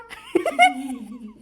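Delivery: sudden high shriek of a laugh; real laughing outburst; loud burst of laughter. Real, not acted. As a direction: crack up loudly at your own story.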